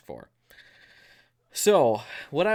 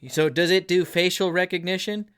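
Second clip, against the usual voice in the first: lightly imitated congressman, higher and louder; mock congressmen's question; 6 semitones higher, 5 dB louder than usual. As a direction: put the question like a lawmaker at a hearing. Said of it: "then they're like".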